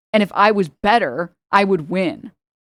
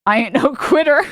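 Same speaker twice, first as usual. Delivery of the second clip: laughing mock defiance; 5 semitones higher, 5 dB louder than usual. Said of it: "I'm like".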